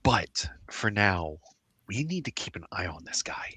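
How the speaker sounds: low urgent whisper. Acted. Whispered warning.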